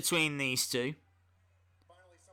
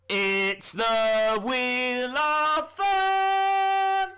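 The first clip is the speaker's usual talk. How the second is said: very slow, drawn-out, higher game show call, louder; game show announcer; 10 semitones higher, 5 dB louder, much slower than usual. Acted. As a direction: stretch every word like a TV host. Grand reveal of the wheel.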